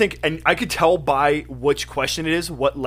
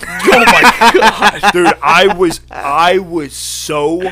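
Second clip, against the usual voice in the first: laughing, louder and higher; 5 semitones higher, 12 dB louder, much slower than usual. Real, not acted. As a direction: laugh out the words Oh my God.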